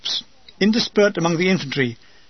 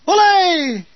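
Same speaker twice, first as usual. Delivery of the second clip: high, loud hammed-up Olé; mock Spanish cheer; 10 semitones higher, 4 dB louder than usual. Imagining the old man's comment, a hammed-up high Olé. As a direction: toss off a jokey Spanish cry.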